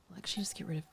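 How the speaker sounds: fast hushed whisper; whispering while deleting a piece. Real, not acted. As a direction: rush it out under your breath. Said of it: "I don't know why I'm whispering".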